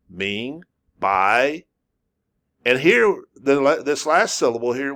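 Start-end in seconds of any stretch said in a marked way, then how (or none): 0.1-0.6 ming rising like a question; rising second tone. Shown as a demonstration. Teacher showing the rising tone on ming. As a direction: let ming climb like you are asking. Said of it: "like I'm asking a question".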